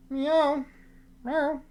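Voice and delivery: a light, high mock meow to the cat; playful meow. Streamer meowing playfully at her cat on camera, high mock meow. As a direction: meow back at the kitty like a cat.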